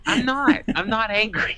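high, mock-defensive denial; playful high-voiced denial; high-pitched protest. Mock protest at being called angry.

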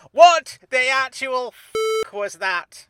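high, loud and slow disbelief; outraged yell. Acted. Steve yelling at Frank about the song, outraged. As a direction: scream it at the song you just heard.

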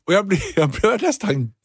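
overwhelmed, laughing through it; excited. Real, not acted. Emotional comeback story.

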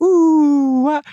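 put-on high, loud baby-cooing ooh. Admiring a baby on the street, loud high coo. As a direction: coo like you're greeting an infant. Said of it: "it's okay to be like".

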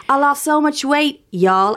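unsure, put-on Southern voice; amateur Southern accent. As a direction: have a go at a Southern drawl. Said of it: "I don't know what the Southern accent is".

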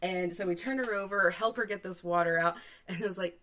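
usual speaking voice

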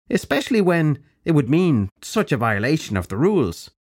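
put-on high, bright-edged old witch; old crone voice. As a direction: speak as a brisk old crone. Crone character voice.

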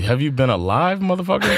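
stunned, scornful rhetorical question; incredulous outburst. Real, not acted. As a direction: pitch jumps high in disbelief.

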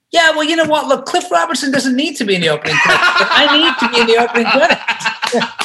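high, laughing mock insistence; laughing retort. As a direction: retell your own cheeky demand with a laugh. Retelling own defiant reply.

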